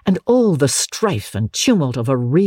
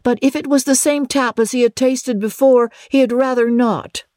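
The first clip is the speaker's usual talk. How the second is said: character voice; 10 semitones higher than usual. A narrator's reading. Schoolmaster's reply voiced in story.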